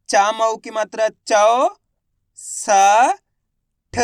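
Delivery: each part of चौसठ held and separated by pauses; syllable by syllable. Shown as a demonstration. Teacher showing learners how to read चौसठ, syllable by syllable. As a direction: spell out चौसठ slowly, sound by sound. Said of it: "एक अक्षर का उच्चारण करके".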